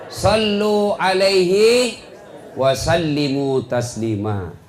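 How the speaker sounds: slow, chanted Quranic recitation. Acted. Preacher reciting the salawat verse to the crowd, slow chant. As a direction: intone the verse slowly like a qari.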